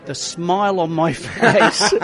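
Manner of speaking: laughing. Real, not acted. Laughing while recalling first acceleration.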